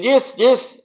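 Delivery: high, squeaky yes yes; mock scammer. Caller answering eagerly, high squeaky mock voice.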